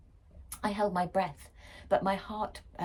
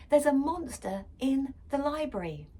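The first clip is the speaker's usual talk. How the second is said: urgent; 8 semitones higher, 6 dB louder than usual. A narrator's reading. Daughter bursts in urgently.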